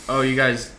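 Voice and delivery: usual speaking voice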